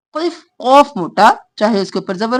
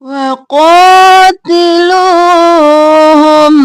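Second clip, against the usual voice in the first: slow, drawn-out tajweed chant; Quran recitation; 3 semitones higher, 6 dB louder, much slower than usual. Shown as a demonstration. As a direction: recite it slowly, stretching the vowels. Reciting verse for students.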